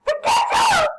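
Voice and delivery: very high, loud yell; angry yell. Real, not acted. Fed-up gamer yells at useless teammates, very high. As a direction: blow up at the team.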